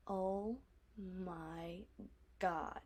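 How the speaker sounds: low, soft, drawn-out exclamation; hushed dread. Real, not acted. Telling viewers about falling asleep tanning, hushed dread. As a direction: let each word sink, slow and quiet. Recalling painful sunburn after beach nap.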